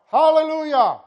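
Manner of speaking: loud, high hallelujah shout; ringing praise shout; crying out hallelujah. Retold or imitated. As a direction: shout it like a great multitude. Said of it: "crying out".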